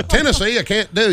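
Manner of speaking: normal talking voice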